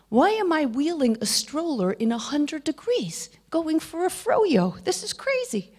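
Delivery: exasperated inner complaint. Retold or imitated. Retold inner complaint in heat.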